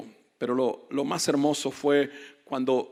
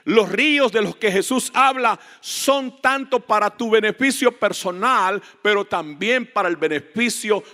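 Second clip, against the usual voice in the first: raised, higher-pitched preaching voice; emphatic preaching; 7 semitones higher, 5 dB louder than usual. Real, not acted. Preacher telling believers the rivers are for their benefit, emphatic preaching. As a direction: project it like a preacher.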